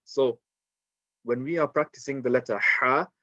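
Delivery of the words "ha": "ha" with a strong breath from mid-throat; throaty ha. Shown as a demonstration. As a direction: push each "ha" out like heavy panting, then hold the vowel. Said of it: "put a lot of strength into it".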